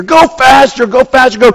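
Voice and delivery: urging. Retold or imitated. Friends urging the driver to speed up, high excited chant.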